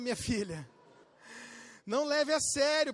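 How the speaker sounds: normal talking voice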